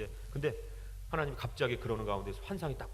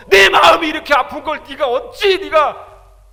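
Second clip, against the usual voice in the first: loud, high, anguished shout; shouted divine rebuke; Jesus rebuking; 13 semitones higher, 21 dB louder, slower than usual. Retold or imitated. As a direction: cry out as grieving Jesus. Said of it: "저한테 그러는 거예요".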